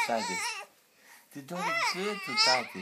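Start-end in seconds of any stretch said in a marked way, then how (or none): 1.3-2.8 father's high coo. Real, not acted. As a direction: lift the voice way up to soothe. Father cooing to hoarse baby.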